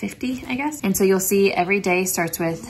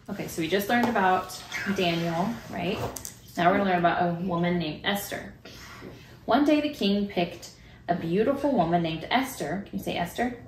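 put-on reading to children; 4 dB quieter than usual. Reads Bible story, prays with child.

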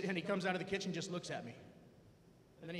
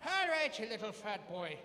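mom scolding. Retold or imitated. Mom scolding in retold story.

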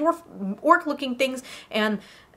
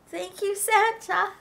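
higher, slow, small voice; tearful gratitude; 5 semitones higher, slower than usual. Acted. Girl wiping tears, thanking Santa meekly. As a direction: say it meekly after crying.

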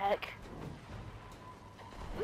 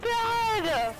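high, drawn-out wailing in exasperation; ear-splitting outburst; 6 semitones higher, much slower than usual. Real, not acted. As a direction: wail it out, laughing at your own failure. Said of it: "I'm so sorry for you guys' ears".

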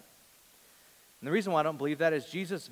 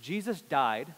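ordinary talk, nothing marked

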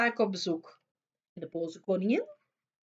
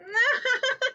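high wicked cackle; evil cackle; 5 dB louder than usual. A narrator's reading. Wicked queen gloats, high cackle. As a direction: cackle in triumph like a witch.

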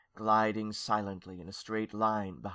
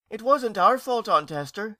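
put-on high, loud child's protest; protesting young ghost; 11 semitones higher, 8 dB louder than usual. A narrator's reading. Nephew defending himself to his aunt, protesting.

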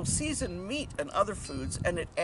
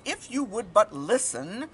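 slow, louder, slightly cross; a little angry; 4 semitones higher, 5 dB louder than usual. A narrator's reading. Hidden voice addresses the father, a little angry. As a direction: raise the voice with measured impatience. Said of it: "louder and a little bit angry".

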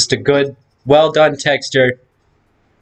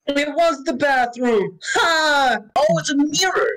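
startled yelp; 12 semitones higher, 4 dB quieter than usual. Real, not acted. Jump scare in dark bathroom.

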